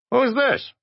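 very high, urgent demand; high-pitched agitated caller; suspicious caller. A narrator's reading. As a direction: shoot the question out high. Agitated caller questions the stranger.

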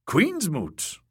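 high, incredulous echo; mocking disbelief. A narrator's reading. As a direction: question it as if it were a joke. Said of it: "Victarion laughed".

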